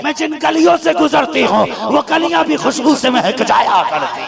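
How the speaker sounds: high, loud sermon climax; impassioned preaching. Acted. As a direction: preach it at full force, voice soaring. Climax of sermon retelling Amina. (जोश से भरा बयान, ऊँची और तेज़ आवाज़)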